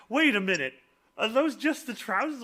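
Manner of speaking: high-pitched puzzled question; rising, incredulous query; mock disbelief. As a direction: imitate someone doing a double take. People's disbelief at costume, acted.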